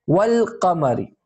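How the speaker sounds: wal qamari with a sounded l; lam pronounced in wal qamari. Shown as a demonstration. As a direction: let the l in wal be heard before qamari. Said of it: "Here, the lam is pronounced".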